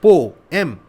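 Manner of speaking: "ई, पो, एम" as separate short syllables; quick little demo of "ई, पो, एम". Shown as a demonstration. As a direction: say "ई", "पो", "एम" briefly, one at a time. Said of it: "इसको बोलने में कम time लगा".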